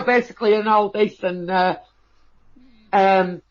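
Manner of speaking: usual speaking voice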